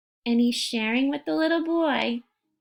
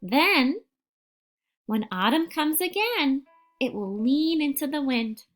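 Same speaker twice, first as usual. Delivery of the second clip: put-on unhurried storytelling voice for a young child; picture book read-aloud. Teacher reading a picture book to a young child, gentle storytelling. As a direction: read it like a bedtime picture book, letting the pauses breathe.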